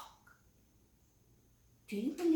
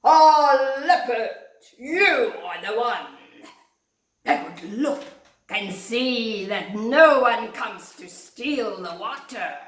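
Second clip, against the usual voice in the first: regal lion king; 3 semitones higher, 10 dB louder than usual. Acted. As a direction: proclaim like a grand king. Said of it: "King Lion said".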